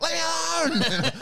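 very high, loud, slow yelled cry; mock frantic scream; imitating an exploding comic. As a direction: burst out like a comic finally snapping. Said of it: "gradual to the point where he's just like".

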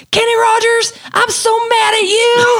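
high, laughing mock yell. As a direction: yell it in a shrill, hammed-up diva voice while cracking up. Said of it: "and she yells".

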